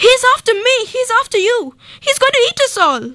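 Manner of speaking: high, fast rising panic; panicky dentist. A narrator's reading. Dentist panics at the crocodile, high and fast. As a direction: blurt it out in terror.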